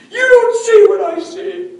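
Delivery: high, loud, slow repeat; angry shout. Retold or imitated. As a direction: throw it back at him just as hard. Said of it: "He was mad".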